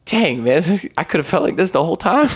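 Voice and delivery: rueful laugh, a bit fast; laughing relief. Retold or imitated. A man laughing at himself after finally praying, fast and amused. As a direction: laugh at yourself quickly. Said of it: "And I'm like".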